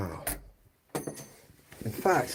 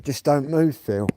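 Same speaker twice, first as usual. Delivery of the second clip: louder and higher, a retold copper's command; police order; 6 semitones higher, 7 dB louder, slower than usual. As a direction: bark it like a policeman stopping him. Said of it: "the copper said".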